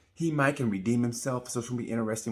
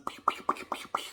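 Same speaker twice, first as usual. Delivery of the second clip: shotgun pews. Retold or imitated. Imitating shotguns.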